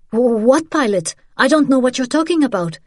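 stammer, then rush out a high denial; high-pitched, hurried protest under threat; alarmed denial. Acted. Pinned woman stammers a denial.

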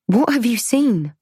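high, urgent frightened question; urgent fear. Acted. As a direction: press her, scared.

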